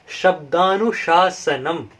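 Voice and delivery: शब्दानुशासनम drawn out syllable by syllable; slow recitation. Shown as a demonstration. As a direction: stretch every syllable of शब्दानुशासनम for the learners. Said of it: "drawn out for learners".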